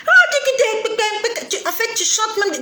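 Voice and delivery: high, stammering exclamation; exasperated outburst. Real, not acted. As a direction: sputter it out in disbelief. (s'écrier, outré, en bégayant)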